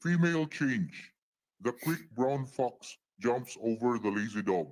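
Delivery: ordinary talk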